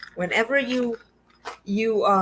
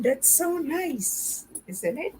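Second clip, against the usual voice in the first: high, slow, drawn-out delight; delighted gush; 8 semitones higher, 4 dB quieter, slower than usual. Real, not acted. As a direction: swoon over the gift, stretching it out. Showing off a gift bag.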